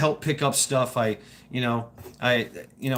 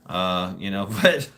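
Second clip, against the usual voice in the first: usual speaking voice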